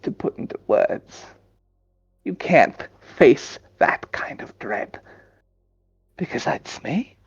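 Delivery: whispering. Acted. Entity speaking in a whisper.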